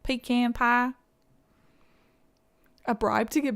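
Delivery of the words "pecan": "Pecan" with a long EE, then the A of "can"; pecan as PEE-can. Shown as a demonstration. Trying the option with pie, "Pecan" as PEE-can. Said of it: "what would be the other option?".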